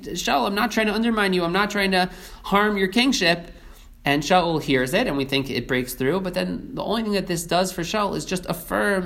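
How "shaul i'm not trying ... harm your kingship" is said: higher and faster, pressing the plea; urgent pleading David. Retold or imitated. David urgently assures King Shaul he means no harm, higher and faster. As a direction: rush the words out, begging to be believed.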